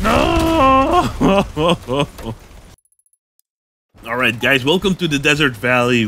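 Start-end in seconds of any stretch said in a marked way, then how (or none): 0.0-1.1 very high loud wail of no; anguished no. Real, not acted. Car destroyed in fight. 1.1-2.3 rueful laugh. Real, not acted. After losing the car.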